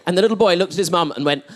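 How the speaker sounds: ordinary talk, nothing marked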